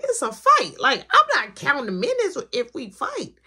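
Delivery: high and loud, hyped for the fight; pumped up. Retold or imitated. Thinking to himself on the ride over, pumped up high and loud. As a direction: rev yourself up for a fight.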